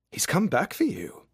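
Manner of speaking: high-pitched friend's character voice; Fitz. A narrator's reading. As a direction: make the point in a high, lifted voice. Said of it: "Fitz says".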